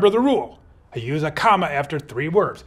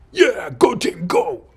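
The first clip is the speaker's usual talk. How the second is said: high, loud cheer for the team; 6 semitones higher, 4 dB louder, much slower than usual. Pretend. Teacher acting out the monkey that cheers, excited high cheer. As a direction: cheer like a fan in the stands.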